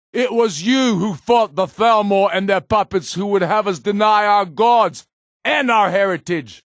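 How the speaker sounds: high, loud oration to a crowd; rousing public speech. Acted. Ulfric rallies his people in the square, booming oration. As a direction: proclaim it to the gathered people.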